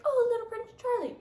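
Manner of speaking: very high, quick squeal of adoration; mock cutesy cooing. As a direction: coo it high, as if at something adorable. Cooing over "cute" Bonnie Prince.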